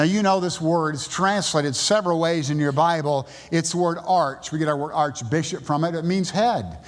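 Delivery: normal talking voice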